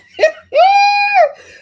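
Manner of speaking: real shrieking burst of laughter; loud high laugh. Real, not acted. Host amused by British women's anger, shrieking laugh. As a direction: let out one long, high, loud cackle.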